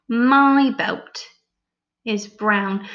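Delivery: slow, each word set apart; slow dictation. Shown as a demonstration. Teacher dictating the sentence slowly. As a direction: say it slowly again.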